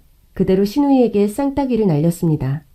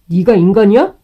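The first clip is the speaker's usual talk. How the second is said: loud furious yell at his sister; furious shout; 7 dB louder than usual. Acted. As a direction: shout it in disgust. Husband yells at his sister.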